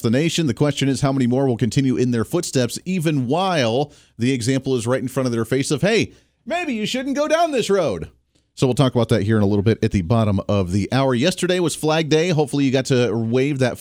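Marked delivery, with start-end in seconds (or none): 5.8-8.1 high mock warning.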